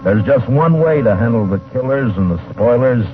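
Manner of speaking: big booming radio opener; show announcer. Acted. Radio host opening the western, booming announcer read. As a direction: announce the show's opening like old-time radio.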